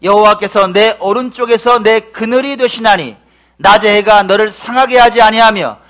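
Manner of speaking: loud, measured recitation; projected, declaimed Bible verse; scripture reading aloud. Real, not acted. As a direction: lead a congregation reading in unison. Leads congregational scripture reading.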